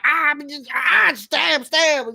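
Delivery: high, loud, mock war cry; hammed-up battle yell. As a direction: yell each stab like you're swinging. Acting out the hero stabbing.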